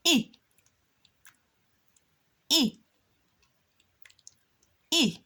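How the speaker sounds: "E" as a short lax i; short i sound. Shown as a demonstration. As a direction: model "E" clearly, short and crisp. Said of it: "The letter I gives a E sound".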